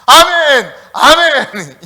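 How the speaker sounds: high, loud demonstration cry; forceful shouted response; training amen shout. Shown as a demonstration. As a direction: belt it out as a drill example. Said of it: "한때 그렇게 훈련시켰어".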